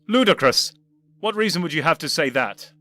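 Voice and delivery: loud, offended echo; indignant and defensive. Acted. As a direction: bristle and defend yourself. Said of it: "indignant and defensive".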